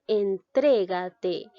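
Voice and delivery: "entrégate" slowed, voice landing hard on TRE; drawn-out en-TRÉ-ga-te for a class; stress on TRE. Shown as a demonstration. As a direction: sound out entrégate syllable by syllable, punching "tré". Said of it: "la mayor fuerza de voz recae en la sílaba".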